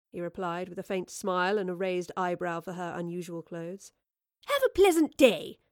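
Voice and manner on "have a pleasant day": high, loud chirpy greeting; chiming. A narrator's reading. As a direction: chime it out cheerfully. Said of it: "she chimed".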